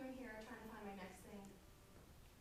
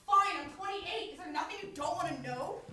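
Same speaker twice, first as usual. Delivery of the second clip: exasperated; 3 semitones higher, 10 dB louder than usual. Acted. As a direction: snap the truth out. Exasperated confession, loud.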